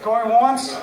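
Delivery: bang the gavel with your voice; auctioneer. Acted. Closes the auction sale.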